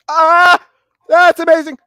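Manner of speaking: very high, loud burst of delight; mock ecstatic squeal. Retelling thrill at wife's Xbox gift, ecstatic squeal. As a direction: squeal it like an overjoyed kid.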